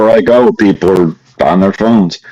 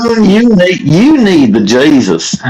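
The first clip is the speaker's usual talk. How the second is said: high, drawn-out mock Southern accent; sarcastic fake Southern accent; 8 semitones higher, slower than usual. Pastor trolling the host, sarcastic fake Southern accent. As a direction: exhort sarcastically in a put-on Southern accent, stretching the end. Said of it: "I do it kinda sarcastically".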